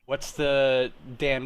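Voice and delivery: usual speaking voice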